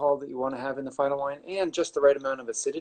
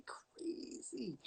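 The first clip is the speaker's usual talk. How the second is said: drawn-out whisper for effect; whispered verdict; 6 semitones higher, 20 dB quieter than usual. Real, not acted. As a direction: drop to a slow, incredulous whisper. Repeats his verdict, hushed for effect.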